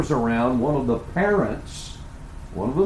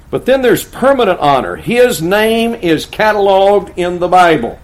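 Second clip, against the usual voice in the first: high, loud pulpit proclamation; emphatic, ringing sermon delivery; full-force preaching; 7 semitones higher, 7 dB louder than usual. Acted. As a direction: proclaim it to the whole church.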